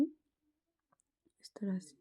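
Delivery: slow, breathy whisper. Real, not acted. Sewing teacher whispering slowly while joining the edges. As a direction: murmur it slowly to yourself. Working close, muttering aside.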